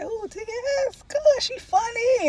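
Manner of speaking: high, squealing fan praise; gushing. Retold or imitated. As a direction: gush like a starstruck fan. Gushing over a favorite celebrity.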